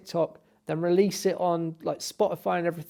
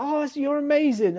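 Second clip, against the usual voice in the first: high-pitched, gushing praise; mock star-struck gushing; excited fan; 9 semitones higher, 3 dB louder than usual. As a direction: squeal it like an adoring fan. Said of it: "so excited".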